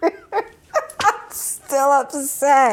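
slow laughing sob; half laugh, half cry, dragged out. Real, not acted. Laugh-cry after complaint.

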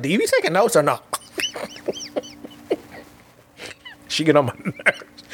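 breaking into laughter while quoting; real amused laughing. Real, not acted. Sis teases about taking notes, amused laughing. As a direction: laugh through the teasing line and after it. Amused retelling of sister's jab.

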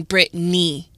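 "Brittany" in two syllables, brit-NEE; pronounced Brit-NEE. Shown as a demonstration. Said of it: "and then Brittany".

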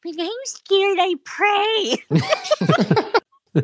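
high-pitched mock of a sweet film character; mocking little girl. Mocking a film girl who made her gag, in a high put-on voice. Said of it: "she made me gag, though, 'cause she was like".

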